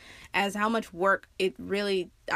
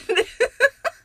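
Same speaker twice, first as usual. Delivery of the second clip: high laugh; 14 semitones higher, 7 dB louder than usual. Real, not acted. Laughing at herself.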